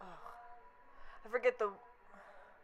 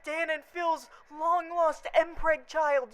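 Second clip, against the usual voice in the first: imagined panicked reaction; 8 semitones higher, slower than usual. Pretend. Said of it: "they're all gonna be like".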